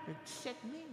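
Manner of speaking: breathy whisper of "Le dix-sept mai"; "Le dix-sept mai" murmuré tout bas. Shown as a demonstration. Whispered take before loud take.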